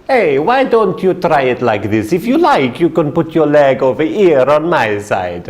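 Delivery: a hint of a teasing Italian businessman; Italian gentleman. Italian seatmate teasing the cramped tall man, light Italian accent. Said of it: "there's an Italian gentleman".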